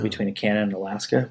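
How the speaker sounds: ordinary talk, nothing marked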